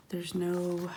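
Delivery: ordinary delivery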